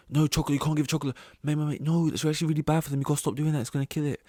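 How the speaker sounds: a pushy coworker wagging his finger; mock fussy lecture. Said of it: "he was saying".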